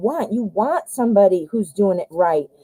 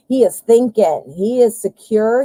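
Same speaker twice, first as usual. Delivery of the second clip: ordinary talk, nothing marked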